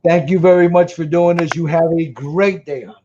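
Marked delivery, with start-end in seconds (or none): none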